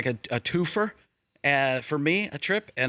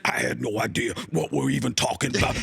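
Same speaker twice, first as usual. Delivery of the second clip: lower, drawn announcer voice; Michael Irvin impression; 7 semitones lower than usual. Imitating Michael Irvin on the show, low. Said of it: "I tried to work in a Michael Irvin".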